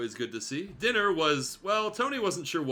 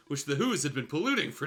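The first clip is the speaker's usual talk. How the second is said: normal talking voice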